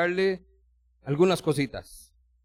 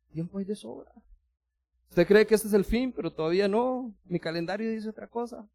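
gentle divine calm; 6 semitones higher than usual. Acted. God answers calmly, softly.

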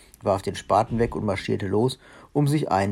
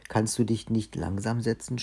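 ordinary delivery, nothing marked

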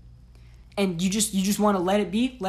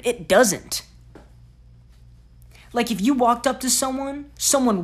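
louder, heated demand; raised rant; 3 semitones higher, 7 dB louder than usual. Real, not acted. Lecturing viewers who care what others think, louder. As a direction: push the question hard at them.

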